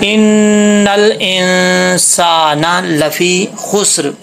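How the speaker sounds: "इन्नल इंसाना लफ़ी खुस्र" drawn out on a level tone, humming the doubled न; slow Quranic recitation. Shown as a demonstration. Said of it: "तो इस पे गुन्ना करेंगे". ("इन्नल इंसाना लफ़ी खुस्र" गुन्ना के साथ खींचकर पढ़ें)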